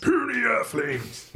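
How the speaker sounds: slow, high booming alien echo; giant alien booming voice. Acted. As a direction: boom it like an alien overlord. Lrrr quote echoed.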